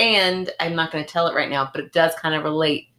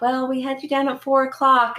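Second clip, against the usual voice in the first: higher-pitched imitation of a receptionist; retold phone voice, pitched up; dental office caller; 8 semitones higher than usual. Office caller telling a late patient, imitated receptionist voice. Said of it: "they called and they're like".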